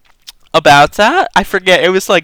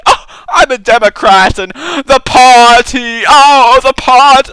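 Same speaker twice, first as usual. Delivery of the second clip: slow, loud mock gushing over the party; mocking party loyalist; 5 semitones higher, 6 dB louder than usual. Mocking a party-obsessed Democrat, gushing loud. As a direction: ham up a party fanatic, rising to a loud squeal. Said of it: "I think it's just really".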